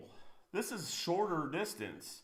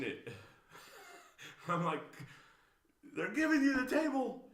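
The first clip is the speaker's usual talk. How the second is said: exasperated laugh; 7 semitones higher than usual. Real, not acted. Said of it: "I don't mean to seem harsh".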